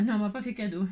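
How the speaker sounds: ordinary talk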